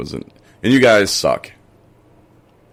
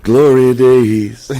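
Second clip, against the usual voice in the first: mock nostalgic; 6 semitones higher, 11 dB louder, much slower than usual. Mocking nostalgia.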